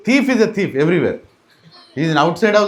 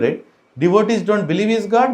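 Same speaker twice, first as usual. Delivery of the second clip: usual speaking voice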